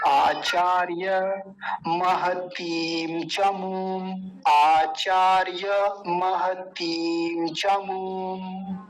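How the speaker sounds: आचार्य महतीं चमूं drawn out, syllable by syllable; slow sanskrit. Shown as a demonstration. Teacher re-pronouncing आचार्य on a student's request. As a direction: enunciate आचार्य slowly and fully. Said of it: "दोबारा pronounce करिए".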